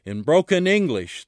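usual speaking voice

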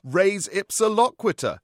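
a lightly voiced vicar proclaiming; declaring vicar. Vicar pronounces the Latin to the room, loud and jolly. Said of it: "declared the vicar".

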